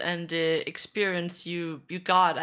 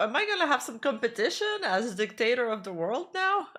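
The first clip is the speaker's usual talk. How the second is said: normal talking voice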